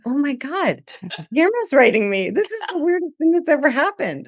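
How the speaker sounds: high, squealing excitement; giddy disbelief. Retold or imitated. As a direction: gush like a fan who just got a message. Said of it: "I was like".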